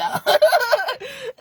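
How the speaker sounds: short high laugh. Real, not acted. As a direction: let out a laugh.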